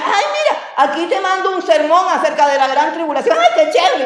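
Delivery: loud, high-pitched mock enthusiasm; excited forwarder. Friend sending a sermon on tribulation, mock gushing. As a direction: imitate someone gushing over a shared sermon.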